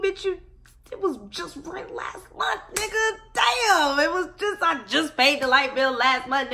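high, loud, outraged disbelief; mock outraged tenant; exasperated rant. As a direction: vent like rent just hit again.

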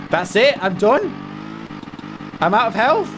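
high-pitched panic, climbing to a shriek; panicked outburst. Real, not acted. Player out of health mid-fight, panicked.

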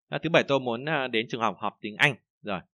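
normal talking voice

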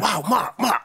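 high-pitched excited exclamation; excited videographer. Retold or imitated. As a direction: act out an enthusiastic friend blurting it out. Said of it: "he's like".

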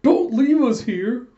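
high, loud, drawn-out wail; hammed-up desperate call; comic pleading. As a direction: beg like abandoned cartoon sidekicks. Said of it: "do the whole gag of".

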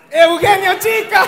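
grita el nombre como anunciador, agudo y lento; mock announcer shout. (grito de anunciador burlón)

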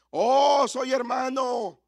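high, loud mock proclamation, stretched slow; mock shout. Preacher mocks a believer who shouts about his faith, loud and high. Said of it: "No tienes que gritar".

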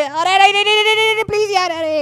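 high, rapid-fire begging; panicked pleading. Real, not acted. Player begging the attacker to spare him, panicked pleading.

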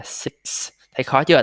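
ordinary delivery

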